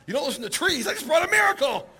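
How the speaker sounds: high-pitched, laughing disbelief; comic exasperation, pitch shooting up; incredulous protest. Retold or imitated. Exasperated reaction to rabbis' refusal.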